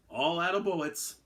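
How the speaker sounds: a light Elmer Fudd impression; lightly voiced cartoon hunter. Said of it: "in his best Elmer Fudd voice".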